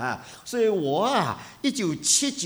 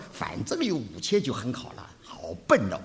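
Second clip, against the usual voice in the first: usual speaking voice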